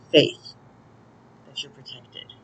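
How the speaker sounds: ordinary talk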